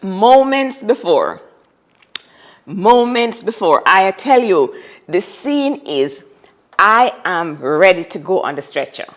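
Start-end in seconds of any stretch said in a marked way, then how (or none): 0.0-3.8 slow, weighted repetition; slow emphatic repeat. Real, not acted. Telling the listener dramatically, slow and emphatic. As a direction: lean hard on moments, slowly. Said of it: "repeated for emphasis".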